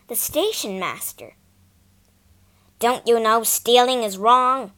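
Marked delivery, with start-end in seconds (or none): 2.8-4.7 loud, stern scolding shout. Acted. Station master shouting at a boy caught stealing coal. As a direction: bark the question at him. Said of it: "he shouted".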